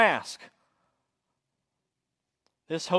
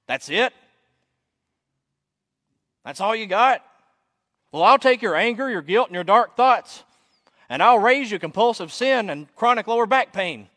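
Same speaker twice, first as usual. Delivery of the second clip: loud, raised challenge from across a room; booming heckler; 6 semitones higher, 7 dB louder than usual. Acted. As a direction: boom it out, topping the other's complaints. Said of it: "a voice booms from the back of the room".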